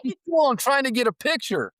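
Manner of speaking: high, excited imitation of Kelly; Kelly yelping while grabbing the camera; freaked-out wife. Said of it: "'Cause she's freaking out".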